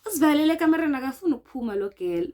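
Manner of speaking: rushed, loud retelling of the accusation; mock accusing shout. Retelling the housemates' accusation, loud and rushed. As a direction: fire it off quickly and loudly. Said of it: "They saying it in Pedi".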